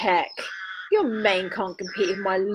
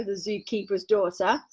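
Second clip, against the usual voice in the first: usual speaking voice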